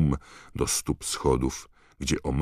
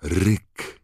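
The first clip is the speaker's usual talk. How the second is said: usual speaking voice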